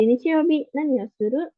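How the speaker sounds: "Nichiyoubi" lifted, then "nani o suru?" rising at the end; rising question intonation. Shown as a demonstration. Teacher models the casual question with rising intonation. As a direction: ask it casually, with the voice going up. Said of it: "diganti dengan intonasi yang naik".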